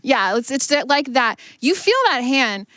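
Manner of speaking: ordinary talk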